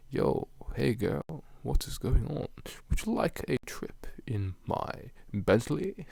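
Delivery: slow mock-British pick-up line; drawn-out British smooth talker; suave British voice. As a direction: flirt slowly in put-on British. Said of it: "if I spoke like this all the time".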